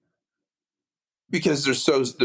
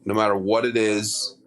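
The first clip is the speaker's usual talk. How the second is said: usual speaking voice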